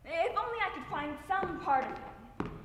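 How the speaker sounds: pleading outburst of grief; desperate longing. Acted. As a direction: let the longing surge out. Grieving mother's wish, intensified.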